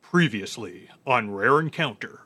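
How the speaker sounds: deep TV announcer intro; previously on recap. Pretend. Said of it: "let me do this".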